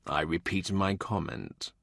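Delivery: low, soft, slow and dry; stern old man. Acted. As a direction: drop it flatly with quiet disdain. More dry reply.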